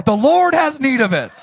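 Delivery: high, loud, laughing mock-holy answer; hammed-up pious voice through a laugh; mock solemn claim. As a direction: declare it like a cheeky saint. Joke reply to car owner.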